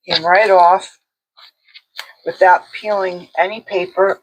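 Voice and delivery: usual speaking voice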